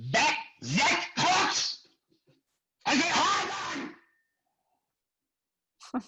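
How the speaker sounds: put-on high, drawn-out French-accented outrage. French director accusing the dead extra, outraged French accent. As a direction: imitate a French director building to a shriek. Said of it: "It's French, this asshole".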